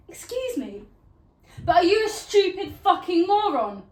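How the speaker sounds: high "excuse me" building to a loud insult; indignant outburst. Acted. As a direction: explode at a stranger, high and loud. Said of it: "I can't stop myself from thinking".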